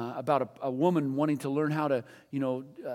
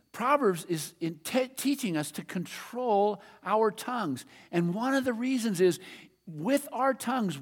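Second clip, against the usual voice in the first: ordinary delivery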